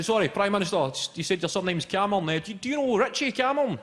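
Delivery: high, loud, excitable nosy local; eager mock interrogation. Comic as Scot quizzing prime minister on his surname, high and excited. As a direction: pester him with eager high-pitched questions.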